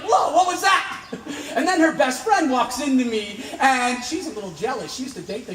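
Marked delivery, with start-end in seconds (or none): 0.0-1.0 loud, high, laughing whoa; jolted, half laughing; startled. Retold or imitated. Dreamer as the bride walks out of her, startled laugh. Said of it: "And I'm like".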